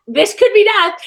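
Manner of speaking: high-pitched mock dread; retold alarm. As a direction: act out sudden panic, half joking. Retold dread facing the eagle.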